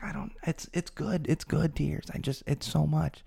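high, soft, faltering retold reply; retold tearful reply. Crying drummer answering his buddy Seth, mildly tearful retelling. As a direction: lightly imitate being overwhelmed by good tears. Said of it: "just started crying".

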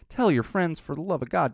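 ordinary delivery

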